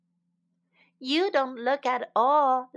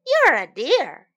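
higher, loud storybook shout; raised, emphatic acted retort; 4 semitones higher, 10 dB louder than usual. A narrator's reading. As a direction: shout it back as the character in an argument. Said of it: "shouted the deer".

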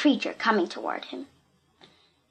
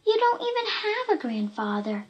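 reproachful; 11 semitones higher than usual. A narrator's reading. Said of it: "said Anika reproachfully".

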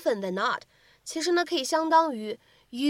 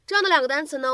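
ordinary delivery

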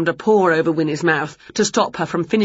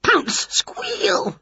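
high rat squeal; 11 semitones higher than usual. A narrator's reading.

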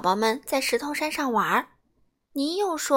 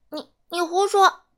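indignant; 6 semitones higher, 8 dB louder than usual. Acted. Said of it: "别的甲龙宝宝说".

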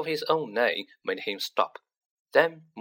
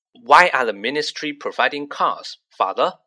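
a touch of pompous dignity, louder; lightly voiced dignified son; 8 dB louder than usual. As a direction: speak like a self-important young man. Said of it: "in a dignified voice".

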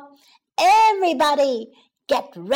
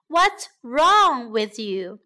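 usual speaking voice

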